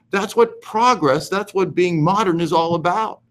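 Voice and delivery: usual speaking voice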